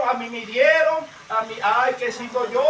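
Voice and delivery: loud mock lament; hammed-up self-pity; playing the victim. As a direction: wail mockingly like a wounded victim. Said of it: "siempre queremos hacernos la víctima".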